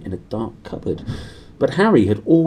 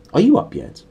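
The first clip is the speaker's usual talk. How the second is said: high, loud demanding aunt; 8 semitones higher, 5 dB louder than usual. Acted. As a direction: bark the question through the door. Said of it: "she demanded".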